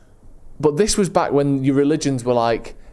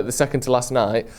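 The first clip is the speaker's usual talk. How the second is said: normal talking voice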